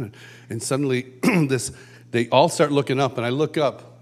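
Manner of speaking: ordinary talk, nothing marked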